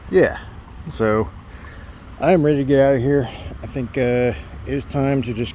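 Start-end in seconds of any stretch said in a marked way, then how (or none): none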